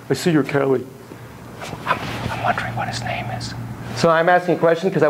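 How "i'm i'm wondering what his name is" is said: hushed off-camera whisper. Real, not acted. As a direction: whisper it aside.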